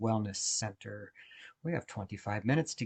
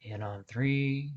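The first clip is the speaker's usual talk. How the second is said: guided meditation. Acted. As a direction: guide listeners gently through each breath, unhurried. Leads a guided breathing meditation.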